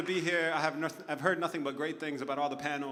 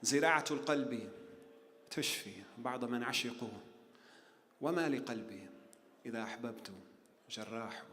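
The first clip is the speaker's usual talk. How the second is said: much softer, hushed close of the poem; softly recited; 4 semitones lower, 6 dB quieter, much faster than usual. Acted. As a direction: let the last verses sink to near a murmur. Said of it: "He said".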